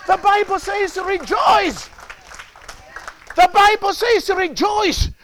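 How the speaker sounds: high, sustained preacher's shout; full-force preaching. Real, not acted. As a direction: proclaim it to the congregation.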